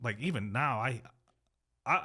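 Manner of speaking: usual speaking voice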